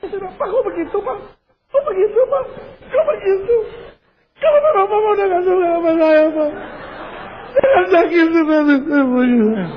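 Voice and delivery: high-pitched mock sobbing plea; wailing wife. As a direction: wail like a heartbroken wife, hammed up. Hurt wife wails in acted quarrel.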